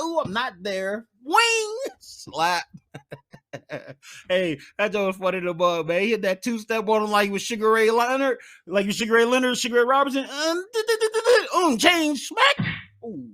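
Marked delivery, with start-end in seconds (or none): 1.2-1.8 high swoosh noise; high whooshing sound effect. Retold or imitated. Sound effect of slap swing. 10.2-12.7 high stuttering du-du noises; stuttered sound effects. Retold or imitated. Imitating slap sound effects.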